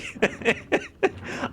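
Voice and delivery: high, airy run of giggles; real helpless high giggle; helpless giggling. Real, not acted. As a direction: keep giggling, unable to speak. Cannot stop laughing at percentage.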